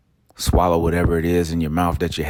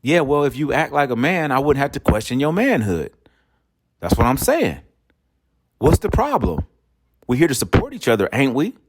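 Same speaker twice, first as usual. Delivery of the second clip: wife arguing; 6 semitones higher than usual. Retold or imitated. Wife argues back in quarrel.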